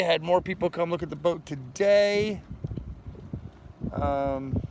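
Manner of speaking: ordinary delivery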